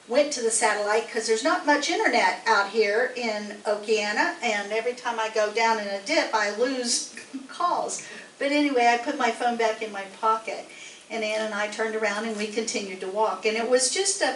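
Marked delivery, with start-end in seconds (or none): 6.3-8.0 slow, laughing through the words. Real, not acted. Woman telling her town about losing calls, laughing. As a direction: chuckle as you admit it. Telling embarrassing walk story.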